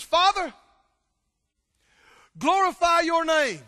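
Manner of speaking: high, loud cry to heaven; loud fervent prayer. Acted. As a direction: call it out, full of awe. Jesus looks up, cries out.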